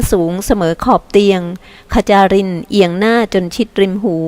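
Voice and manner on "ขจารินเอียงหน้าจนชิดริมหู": usual speaking voice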